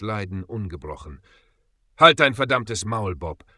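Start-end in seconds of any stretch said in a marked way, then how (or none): 2.0-3.4 loud, pained rebuke through gritted teeth; angry hiss. A narrator's reading. Injured Peter silences note-taking Bob, hissing through gritted teeth. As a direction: snap it through clenched teeth. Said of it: "zischte Peter durch zusammengebissene Zähne".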